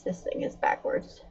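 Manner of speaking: whispered aside to self; muttered under breath. Real, not acted. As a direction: mutter it to yourself.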